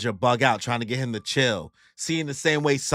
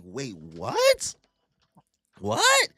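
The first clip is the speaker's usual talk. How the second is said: baffled disbelief; much slower than usual. Retold or imitated. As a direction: squeak the second "what" in mock shock. Retold listeners reacting in disbelief.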